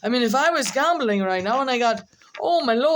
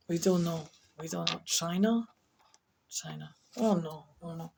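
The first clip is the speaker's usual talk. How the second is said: low, hushed talking to oneself; puzzled muttering; 6 semitones lower, 8 dB quieter than usual. Real, not acted. Speaker puzzles over a confusing dice game, quiet and low. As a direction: think aloud under your breath.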